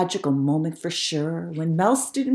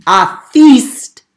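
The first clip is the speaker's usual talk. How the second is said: loud dramatic punch on the last word; jump-scare the listener; sudden boom; 4 semitones higher, 15 dB louder than usual. A narrator's reading. Narrator startling listeners about the hungry lion, loud. Scary lion might eat him.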